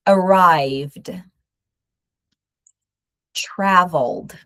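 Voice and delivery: each word said slowly with no extra syllable; pronunciation drill. Shown as a demonstration. Teacher repeating words for students to practise. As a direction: model each past form cleanly. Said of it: "I'm going to repeat those sounds again so that you can listen".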